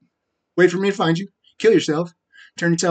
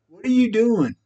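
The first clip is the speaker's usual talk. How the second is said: higher, pressing repeat of the question; exasperated demand; 7 semitones higher than usual. Real, not acted. Repeating the question to Lisa, exasperated.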